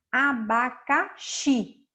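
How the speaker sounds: "abacaxi" broken slowly into a-ba-ca-XI, weight on XI; slow, separated "abacaxi" with a strong last syllable; syllable-by-syllable stress. Shown as a demonstration. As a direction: sound out "abacaxi" by syllables, landing on the final xi. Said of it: "O xi que é a, a sílaba tônica".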